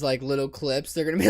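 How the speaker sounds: ordinary talk, nothing marked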